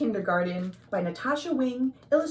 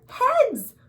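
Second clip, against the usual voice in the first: excited; 13 semitones higher, 6 dB louder than usual. A narrator's reading. Reading to children, big finish.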